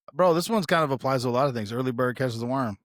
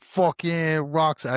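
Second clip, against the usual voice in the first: slow, higher put-on voice trying his accent; attempted Stoll accent; 5 semitones higher, 4 dB louder, much slower than usual. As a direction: drawl it out like the mentor. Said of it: "I can't do his fucking accent".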